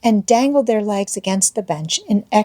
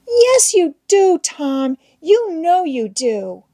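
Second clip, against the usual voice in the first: put-on high, drawn-out girlish whine; tearful; 9 semitones higher, slower than usual. A narrator's reading. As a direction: wail the accusation slowly through tears. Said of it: "The child began to cry".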